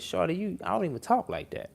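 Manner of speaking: high-pitched, quick protest to his ex; retold protest. A man protesting to his ex over texts, high and quick. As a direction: voice jumps up insisting on innocence. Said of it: "And I said".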